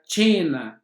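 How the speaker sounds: slow, clear demo of cena with the Italian tch sound. Shown as a demonstration. Teacher to students, showing cena with tch. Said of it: "terá sempre o som do T C H".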